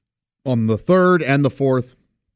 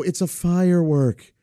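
lightly voiced niece; 4 semitones higher than usual. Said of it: "And she would go".

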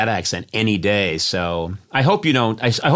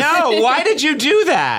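high-pitched comic protest; mock horror; 14 semitones higher, 3 dB louder than usual. Real, not acted. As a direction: object as if appalled, pitch way up.

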